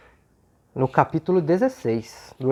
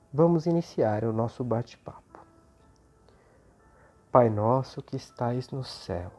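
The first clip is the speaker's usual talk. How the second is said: soft, devout prayer voice; 3 semitones lower, 4 dB quieter than usual. Acted. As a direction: pray gently and reverently. Opening prayer over music.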